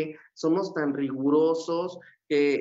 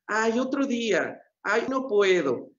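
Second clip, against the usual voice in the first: higher, drawn-out reluctant sigh of an excuse; mock whine, slow and higher; whiny excuse; 5 semitones higher than usual. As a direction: put it off with a lazy little whine. Acted excuse to put off dreams.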